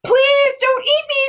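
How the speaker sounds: high, frightened stammer; terrified little girl begging the giant; stammering in fear. A narrator's reading. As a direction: stutter out the plea, terrified. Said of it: "Sophie stammered".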